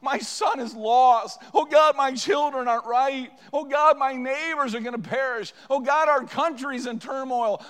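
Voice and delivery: a worshipper pleading for lost family and nation; anguished shouted prayer. Retold or imitated. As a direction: cry out each burden to God. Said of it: "would come this morning ... and say".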